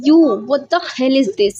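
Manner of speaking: drawn-out, high, loud indignation; high and loud, storybook diva fury; outraged outburst. A narrator's reading. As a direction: stretch the words out in acted outrage. Outraged girl hit by pizza.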